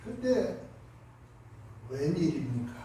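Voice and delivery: ordinary delivery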